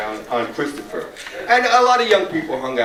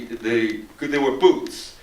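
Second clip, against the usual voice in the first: normal talking voice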